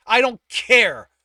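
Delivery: loud, high and slow snap; snapped. A narrator's reading. Tibbs cutting off the server, loud angry snap. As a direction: bite the words off in anger. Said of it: "Tibbs snapped".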